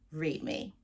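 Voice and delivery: ordinary talk, nothing marked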